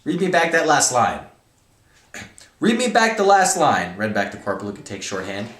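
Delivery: loud, high-pitched animated novel reading; dramatic story reading. Acted. Reading favorite book to viewers, loud and animated. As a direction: act out an irate military hearing at full volume.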